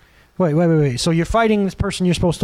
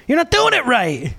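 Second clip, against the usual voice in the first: mock nag; 7 semitones higher than usual. Nagging about vacuuming.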